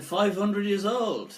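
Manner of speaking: retold surprise. Interviewer reacting to the jinn's age, mock surprise. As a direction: repeat it back in mock amazement. Teller echoes jinn's answer, taken aback.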